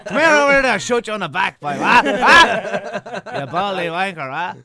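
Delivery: high, loud, laughing Irish Magneto impression; shouted Irish Magneto. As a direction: bellow it like a rowdy Irish Magneto. Mocking Irish Magneto shouting at Xavier.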